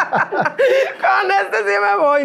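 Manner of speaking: drawn out and high, laughing through it; laughing swoon. Retold or imitated. Recalling her crush on the tall boss, laughing swoon. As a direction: swoon over him, slowly, giggling.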